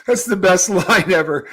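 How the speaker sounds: usual speaking voice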